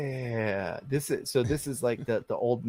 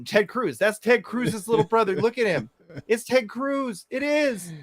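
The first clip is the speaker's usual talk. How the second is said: excited; 8 semitones higher, 3 dB louder than usual. Real, not acted. Insisting on lookalike joke.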